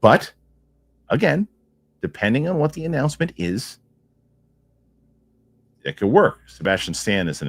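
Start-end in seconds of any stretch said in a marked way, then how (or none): none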